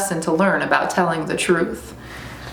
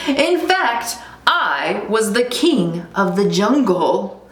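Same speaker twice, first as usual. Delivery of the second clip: boastful lion cub; slower than usual. Acted. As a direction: brag slowly like a little king. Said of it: "said the lion cub".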